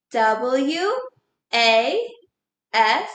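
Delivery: letter by letter with pauses; spelling out. Shown as a demonstration. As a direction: spell W, A, S one at a time. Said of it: "Magic wand ready?".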